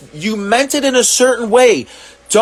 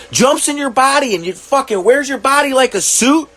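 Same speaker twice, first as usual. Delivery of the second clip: ordinary talk, nothing marked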